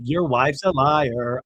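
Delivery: "Your wife's a liar" in a slow sing-song. Retold or imitated. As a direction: stretch "liar" in a slow, mocking singsong. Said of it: "I love how she always sing-songs it".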